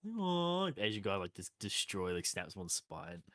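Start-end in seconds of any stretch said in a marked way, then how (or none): 0.1-0.7 high cartoon "aw"; pitched-up dim-dad groan; Homer. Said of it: "and he, like".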